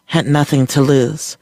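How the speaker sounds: ordinary delivery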